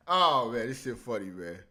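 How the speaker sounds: usual speaking voice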